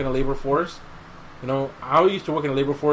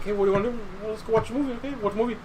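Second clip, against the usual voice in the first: higher, lightly voiced family members; retold family chat; 8 semitones higher, much faster than usual. Retelling family choosing a movie, lightly voiced. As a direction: casually act out the after-dinner exchange. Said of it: "and like".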